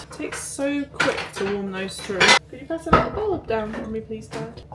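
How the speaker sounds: ordinary talk, nothing marked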